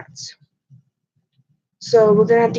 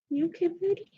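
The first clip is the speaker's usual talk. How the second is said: high, soft coo to the cat; 8 semitones higher, 11 dB quieter, faster than usual. Real, not acted. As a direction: coo gently at a pet.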